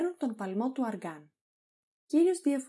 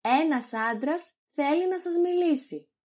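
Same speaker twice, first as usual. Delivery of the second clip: loud, high announcement; raised voice, pitched up; shouting; 5 semitones higher, 5 dB louder than usual. Acted. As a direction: shout every word so he hears. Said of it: "φωνάζοντας".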